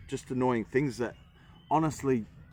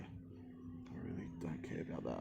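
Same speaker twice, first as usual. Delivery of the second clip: whispered aside; 6 semitones lower, 16 dB quieter than usual. Real, not acted. Dismissive aside.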